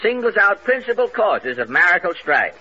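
punchy newsreel announcer read. Acted. Newsreel announcer read over music.

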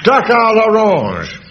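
high, loud and drawn out; comic exclamation. Acted. A poet reveals the recipe heard, loud drawn-out cry. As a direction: call it out slowly and grandly.

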